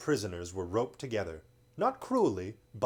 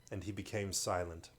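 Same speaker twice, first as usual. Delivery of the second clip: ordinary delivery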